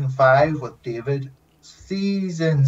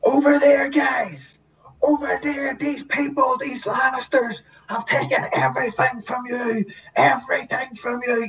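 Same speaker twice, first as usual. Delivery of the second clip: rallying the army; 12 semitones higher than usual. Retold or imitated. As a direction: fire up the troops from the front. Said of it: "trying to get the army fired up".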